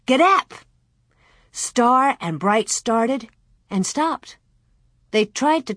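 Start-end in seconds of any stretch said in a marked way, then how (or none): 0.1-0.5 high, sharp call to animals; shouted command. A narrator's reading. Almanzo starts his calves with the whip, shouted command. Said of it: "cracked his whip and shouted".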